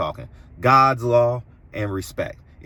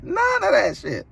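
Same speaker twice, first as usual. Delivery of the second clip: shout. Real, not acted. Ranting about refusing disrespect.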